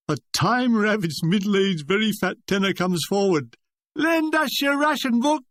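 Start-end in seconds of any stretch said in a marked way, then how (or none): 4.0-5.4 high-pitched call from the audience; heckler's cry. A narrator's reading. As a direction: shout out a mock heckle. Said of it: "came the cry".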